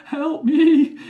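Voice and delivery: high, loud laughing plea; chortling. Retold or imitated. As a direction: squeal it through giggles. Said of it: "she chortled".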